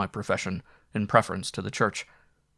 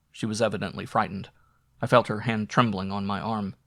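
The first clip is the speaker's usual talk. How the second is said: ordinary delivery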